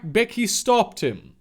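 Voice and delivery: normal talking voice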